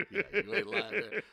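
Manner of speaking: words bubbling up through a laugh. Real, not acted. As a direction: laugh while playing along with the joke. Laughing along at cosigner joke.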